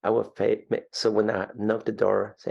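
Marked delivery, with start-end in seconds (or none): none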